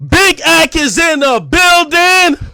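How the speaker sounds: loud, high, slow holler; hype announcement. Real, not acted. Streamer opening his stream, loud drawn-out hype holler. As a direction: belt it out like an arena entrance.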